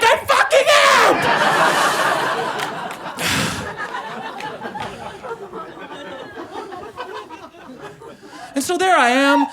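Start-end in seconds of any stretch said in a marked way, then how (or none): 0.0-1.2 exasperated mock yell. Frustrated outburst retold. 1.2-7.5 exasperated sigh. Retold or imitated. After outburst.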